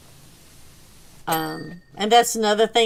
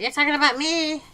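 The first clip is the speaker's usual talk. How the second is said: higher, quick questioning pet voice; voiced dog; 6 semitones higher, faster than usual. Dog butting into the conversation, perky questioning. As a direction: pipe up as the curious dog.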